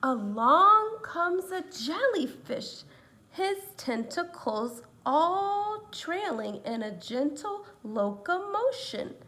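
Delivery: put-on lively read-aloud to children; story reading. A narrator's reading. Teacher reading a picture book to her students, lively. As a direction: read it like a storybook for little ones. Narration slows.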